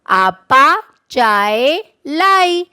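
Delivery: آپا چائے لائی spoken slowly, each word apart; slow model sentence. Shown as a demonstration. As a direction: read it out slowly for the class to repeat. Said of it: "ان الفاظ کے ساتھ جملے بناتے ہیں". (آپا چائے لائی کو آہستہ آہستہ، الگ الگ لفظوں میں)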